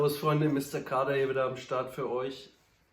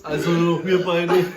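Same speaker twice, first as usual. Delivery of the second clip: laughing; 7 dB louder, slower than usual. Real, not acted. Both burped, laughing.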